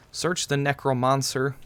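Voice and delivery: slow, odd drawn-out "Search the Necromancer"; said weird on purpose. Shown as a demonstration. Gamer reading a prompt in a deliberately weird voice. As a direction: mangle "Search the Necromancer" slowly for fun. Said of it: "I said that weird on purpose".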